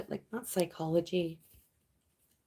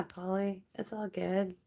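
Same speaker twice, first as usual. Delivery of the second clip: ordinary delivery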